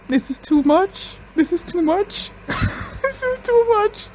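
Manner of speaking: climbing high, drawn-out disbelief; real overwhelmed squeal. Real, not acted. Overwhelmed reaction to shocking scene.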